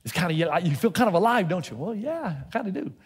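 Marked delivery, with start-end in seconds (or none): 1.8-2.9 sheepish reply. Retold or imitated. His own replies to God.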